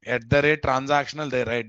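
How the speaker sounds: normal talking voice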